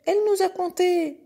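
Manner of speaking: very high, loud calf tattling; complaining calf. Acted. Calf telling the pig about the goat, high indignant voice. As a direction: pipe up shrilly as an aggrieved calf.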